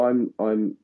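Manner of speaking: ordinary talk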